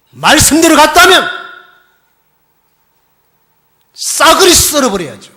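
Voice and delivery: loud, high, driving sermon voice; full-force preaching. Acted. Pastor pressing the congregation, preaching at full force. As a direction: drive the point home to the congregation.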